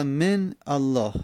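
من الله split as "men, allāh"; slow, separate words in من الله; unlinked silent n. Shown as a demonstration. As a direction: stop on the n of من before الله. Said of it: "من نون ساکن".

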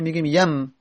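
ordinary talk, nothing marked

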